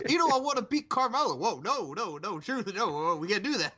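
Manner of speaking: high-pitched swooping protest, laughing near the end; high mock-panicked objection; acted comic dialogue. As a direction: plead in a high, wobbling comic voice. Acting out R-Truth's comic exchange.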